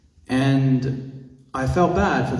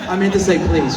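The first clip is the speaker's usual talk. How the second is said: normal talking voice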